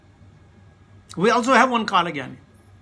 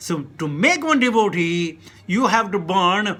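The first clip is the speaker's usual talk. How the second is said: ordinary delivery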